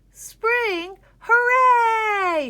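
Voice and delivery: very high, loud cheering shout; joyful shout. A narrator's reading. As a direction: cheer like a thrilled crowd. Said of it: "shouted the crowd".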